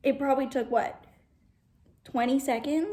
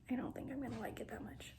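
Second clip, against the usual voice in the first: whisper; 12 dB quieter, faster than usual. Real, not acted. Fast whispered aside.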